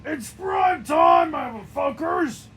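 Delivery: slow, high, loud war cry; shouted taunt. Acted. Character taunts enemies before the fight, shouted war cry. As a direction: roar it out like a wrestler's entrance.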